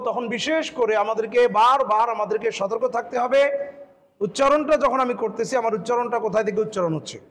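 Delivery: ordinary talk, nothing marked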